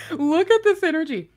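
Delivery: loud, high, through laughter; laughing. Real, not acted. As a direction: say it while cracking up. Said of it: "I'm kind of laughing".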